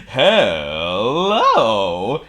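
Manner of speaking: loud, drawn-out jolly greeting; swooping, cheerful character voice; grinning Mister Happy. A narrator's reading. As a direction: call it out with a big grin. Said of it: "he grinned".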